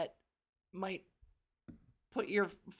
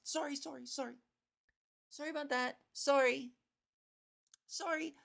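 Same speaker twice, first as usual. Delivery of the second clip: higher-pitched, polite little sorries; mock Canadian apologies; 5 semitones higher than usual. Shopper and lady trading sorries, mock apologetic. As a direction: scatter put-on apologies in a lifted voice. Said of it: "the lady and I did our sorry fest".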